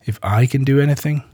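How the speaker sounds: understated, questioning comeback; retort. A narrator's reading. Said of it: "retorted Jesus".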